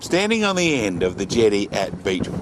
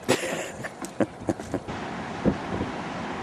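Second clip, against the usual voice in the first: breathy laugh; 10 dB quieter than usual. Real, not acted. After tasting salt.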